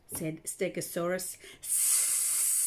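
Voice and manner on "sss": long unvoiced hiss like a snake. A narrator's reading. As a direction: hiss like a great big snake. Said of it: "went the hose like a great big snake".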